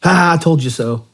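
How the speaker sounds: high, loud taunting "ha"; mocking gloat. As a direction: gloat like a smug winner. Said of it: "the right thing to do is not go".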